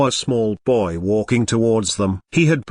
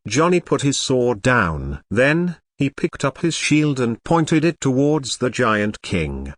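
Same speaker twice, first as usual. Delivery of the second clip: plain reading voice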